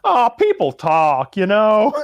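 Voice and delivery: slow, drawn-out mock actor line, rising at the end; hammed-up bad acting; he goes. Friends retelling a movie, mock slow bad-actor delivery. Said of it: "he just goes".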